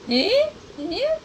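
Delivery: sharply high mock huffy question; mimicking irritated querying. Wife mimicking husband's constant anger, high mocking huff. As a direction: parody a touchy reaction in a high pitch. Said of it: "जब देखो हैं".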